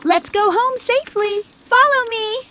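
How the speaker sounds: calling out. Acted. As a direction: call the group along. Leading the group home.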